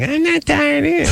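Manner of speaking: very high, mock-girly voice; imitated young woman. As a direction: squeak it like a girl playing along. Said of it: "And she's like".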